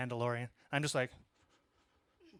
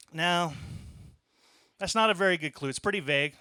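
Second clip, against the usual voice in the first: normal talking voice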